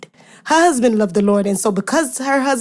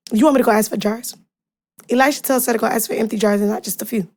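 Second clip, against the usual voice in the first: ordinary talk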